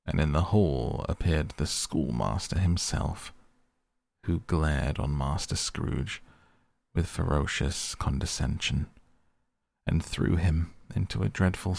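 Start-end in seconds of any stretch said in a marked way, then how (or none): none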